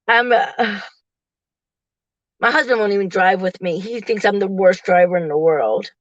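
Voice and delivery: ordinary talk, nothing marked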